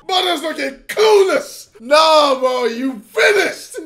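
laughing shout. Real, not acted.

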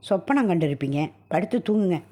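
plain reading voice

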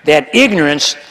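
booming pulpit proclamation; preaching at full force. Acted. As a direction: thunder it out to the congregation.

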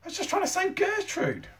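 put-on very high-pitched boy's protest. A narrator's reading. Eric defending his rescue of Gertrude, protesting. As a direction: plead your case in a high voice. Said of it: "protested Eric".

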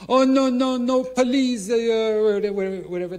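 high, loud put-on foreign voice, panicking; mock panicked bag lady. Bag lady begging the tourist not to call police, high panicked mock voice. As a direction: squeal it in a fake accent. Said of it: "I said".

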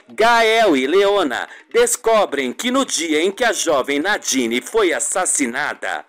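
normal talking voice

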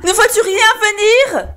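high, wailing question; desperate cry. A narrator's reading. Poor wife asking her sister for rescue, crying out. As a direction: call out the question frantically. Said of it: "hurla la pauvre femme".

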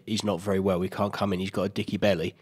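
soft low mock mum on the phone; a hint of a worried mum excusing her son; lightly voiced mum. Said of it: "his mum phoned up and said".

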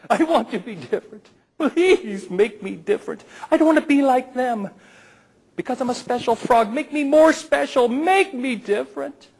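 high, pleading frog voice, rising to a cry. Acted. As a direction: beg the star like a desperate little frog. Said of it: "cry out every night".

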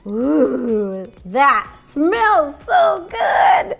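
very drawn-out, relishing exclamation; savouring delight. Real, not acted. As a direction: swoon slowly over a delicious smell. Savouring smell of melted butter.

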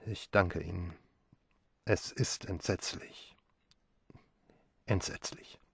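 hoarse, near-whispered, broken by shock; barely any voice left from grief; grieving son thanking the colonel, hoarse. A narrator's reading. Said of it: "sagte heiser".

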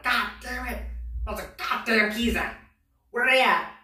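high-pitched angry holler; angry grandmother. Retold or imitated. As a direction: demand it, mad as hell, imitating grandma. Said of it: "just angry as hell".

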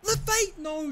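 high, loud cry of despair; real exasperated shout; anguished yell. Real, not acted. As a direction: yell the name, then protest in dismay. Player misses again.

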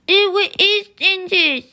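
high, slow, loud cry of disgust. Acted. Brother biting the toothpaste cookie, disgusted wail. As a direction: recoil from the taste with a wail.

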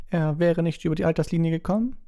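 cutting, high-pitched retort; McGonagall. A narrator's reading. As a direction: cut in sharply, pitch high. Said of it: "sagte Professor McGonagall schneidend". (schneidend, mit hoher Stimme)

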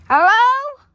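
loud, high rising call. Pretend. Searcher calling for the kidnapped friend, loud and rising. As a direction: call out to whoever might be there.

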